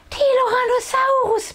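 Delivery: put-on very high happy baby squeak. A narrator's reading. Baby sister happily calling her brother, high squeak. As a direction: squeak out his name with joy. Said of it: "piepst Tienchen glücklich".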